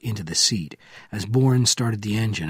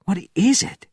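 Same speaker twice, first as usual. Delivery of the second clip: high startled question; sudden high alarm in the voice; 10 semitones higher than usual. Acted. Young soldier asking Bourne in alarm, high and startled.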